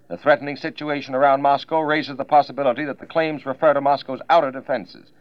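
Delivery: 1940s radio news read; crisp wartime bulletin delivery; old newsreel. Acted.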